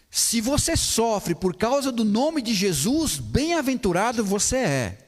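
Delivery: normal talking voice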